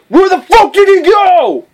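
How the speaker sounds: high-pitched, bewildered shout; baffled guard yell. Acted. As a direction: yell it like a guard who lost his target. Acting out baffled enemy guards.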